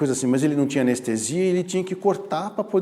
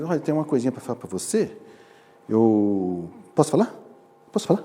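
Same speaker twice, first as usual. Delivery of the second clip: timid asking; 4 semitones lower, 3 dB quieter than usual. Shown as a demonstration. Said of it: "Esse é o passivo".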